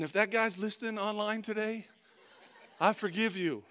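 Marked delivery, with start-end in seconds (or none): none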